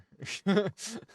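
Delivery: sudden loud laugh; burst of laughter. Real, not acted. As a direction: break into laughter at yourself. Laughing at own story.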